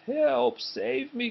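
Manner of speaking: high, frightened cry; panicked cry for help. Acted. Percy, terrified of the dragon, crying help. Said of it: "cried Percy".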